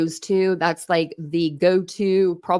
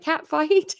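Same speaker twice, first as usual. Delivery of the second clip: high, teasing mock-commenter voice; quoted commenter; 9 semitones higher than usual. Someone in the comment section egging her on, high mock taunt. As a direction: pitch it way up as a playful taunt. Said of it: "someone was like".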